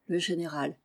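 ordinary delivery

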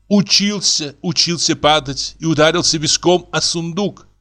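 tearful-sounding character voice; distraught wife. Acted. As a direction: explain woefully what happened. Actor's wife explains plaintively.

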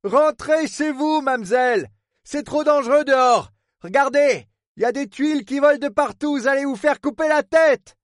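high, urgent warning over a storm; harbour superintendent. Acted. Port superintendent sends a young woman home, urgent warning.

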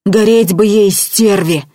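loud curse in a frightful voice; vicious loud curse; terrible voice. A narrator's reading. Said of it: "вдруг страшным голосом сказал он".

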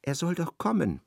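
ordinary talk, nothing marked

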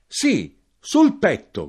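high, loud, short cry; shouted. A narrator's reading. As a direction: shout it back emphatically. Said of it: "gridai io".

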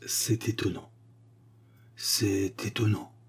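hushed whisper, slow; murmuring. Acted. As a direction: murmur to himself in disbelief. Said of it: "murmurait monsieur Blaireau".

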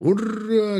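high hurrah from afar; charging battle cry. A narrator's reading. Attacking cry rings out ahead, high hurrah. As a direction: pitch the cheer high, without great force. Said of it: "гремел впереди атакующий клич".

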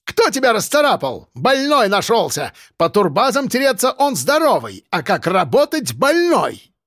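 high, strident tirade; scornful high-pitched scolding; exploding in anger. A narrator's reading. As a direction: berate him as an outraged woman boss. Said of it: "Зинаида Михайловна взорвалась".

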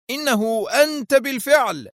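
very high-pitched shocked exclamation; thunderstruck cry. A narrator's reading. Isaac reeling at the unmasked face, aghast. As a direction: cry out as if struck by lightning. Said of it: "فتراجع إيزاك كالمصعوق وهتف".

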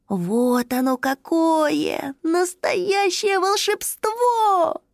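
high, slow cry of delight. Acted. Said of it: "глаза засветились от восторга".

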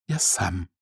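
very soft, weak murmur; whispered. A narrator's reading. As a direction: barely get it out, hushed. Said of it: "прошептал он".

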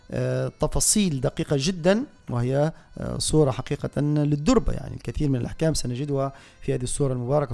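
ordinary talk, nothing marked

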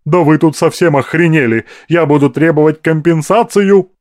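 high, loud screechy protest; outraged squawk. A narrator's reading. Madam storming out demanding compensation, screeching. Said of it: "заверещала женщина".

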